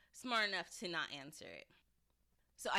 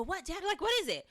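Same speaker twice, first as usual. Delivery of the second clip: high-pitched, urgent imitation of worried kids; mock urgent reply; 11 semitones higher, 6 dB louder, faster than usual. Kids running to their screaming dad, mock-urgent. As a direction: call back as if alarmed, voice jumping up.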